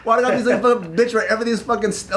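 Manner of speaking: high, fast mock whining; whiny self-pity. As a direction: rush it out as a hammed-up whimper. Acting out his fussy bedtime self-pity.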